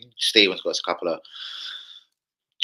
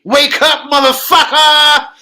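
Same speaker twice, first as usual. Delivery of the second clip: screamed much higher and louder, slow on the curse; bear voice yell; 14 dB louder, much slower than usual. Pretend. Recording his voice for a teddy bear, a screaming wake-up call. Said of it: "That's what I'll put in a bear's voice".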